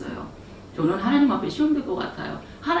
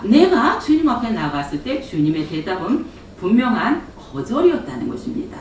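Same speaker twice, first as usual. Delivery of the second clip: ordinary talk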